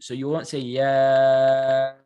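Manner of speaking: "ya" dragged out very long and slow; deliberately wrong long "ya"; wrong overlong vowel. Shown as a demonstration. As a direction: stretch "ya" far too long on purpose. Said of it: "That's wrong".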